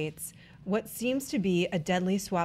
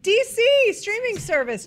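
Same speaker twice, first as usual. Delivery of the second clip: excited; 12 semitones higher, 9 dB louder than usual. Real, not acted. Host announcing topics excitedly.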